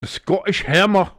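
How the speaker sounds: loud, high mock-Scottish voice; hammed-up Scottish nickname call; terrible Scottish accent. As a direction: belt it out in a bad Scottish accent. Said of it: "That's my terrible Scottish accent".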